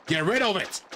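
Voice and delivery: broad Australian complaint; Australian dolphin. Acted. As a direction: order it in an Australian accent. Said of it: "is this dolphin Australian?".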